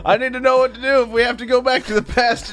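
high, laughing put-on yokel; squeaky voice through laughter. Voicing the Nebraska listener.

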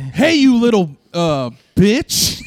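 loud, high barking drill sergeant; drill sergeant yell. Pretend. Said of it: "little Arlie Ermy".

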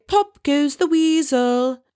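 high, slow sing-song switch of persona; switching persons. Retold or imitated. Simon covering his face to change persona, high slow sing-song. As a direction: flip into a silly new self. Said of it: "like you're changing persons".

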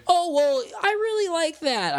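very high-pitched, loud mom impression. Podcaster imitating mom approving of the show, in a very high mom voice. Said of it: "that's my mom voice right there".

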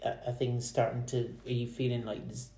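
normal talking voice

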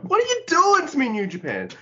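high, loud mock complaint; mock outrage. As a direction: wail it at the promotion in jest. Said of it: "So I'm like".

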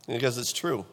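ordinary delivery

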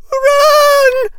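very high, loud drawn-out scream; screamed warning. A narrator's reading. Captive Hannah warns her friends, screaming. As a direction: scream for them to flee. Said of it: "screamed Hannah as she was".

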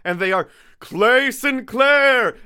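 high, loud, slow announcer voice; SNL announcer. Acted. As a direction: announce the name like a TV show. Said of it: "That's my S N L vo".

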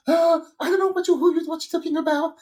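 mild mock panic; flustered denial. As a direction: play it caught out and rattled. Flustered friend on phone.

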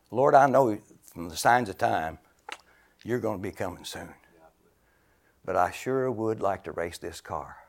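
confiding a wish to God, hushed; quiet prayer. Retold or imitated. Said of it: "I said".

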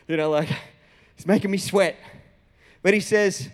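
usual speaking voice

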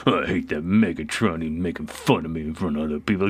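low, sulky muttered grumble; grumbling complaint. Retold or imitated. Retelling Six Shot's sulking, low grumbling complaint. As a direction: grumble it under your breath like a sulking robot. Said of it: "just grumbling and complaining the whole time".

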